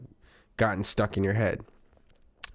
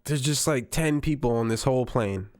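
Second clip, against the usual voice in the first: ordinary talk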